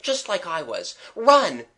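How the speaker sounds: high, alarmed, shouting each run; rally them in alarm, bursting out loud on the command; urgent cry. A narrator's reading. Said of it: "cried Gerald".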